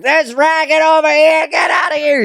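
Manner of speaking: put-on high shouting old man; angry yell. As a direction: yell him out of the room. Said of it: "when he's like".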